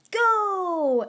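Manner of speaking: high, loud "Go!" like an announcer; starting shout. Acted. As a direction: bellow the start signal. Present Mic starts the exam.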